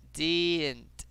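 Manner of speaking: "didn't" stretched slowly; drawn-out demo. Shown as a demonstration. Teacher models an easy word for students, drawn out. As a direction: draw out the first vowel of "didn't". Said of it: "Repeat after me".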